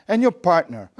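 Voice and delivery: normal talking voice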